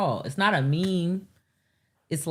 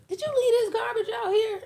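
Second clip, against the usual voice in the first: high-pitched imitation of an accusing lady. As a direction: put on a high lady's voice.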